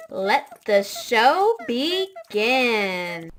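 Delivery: very slow, drawn-out and loud; grand, elongated announcer delivery; show-opening announcement. Real, not acted. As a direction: stretch each word like a host raising the curtain.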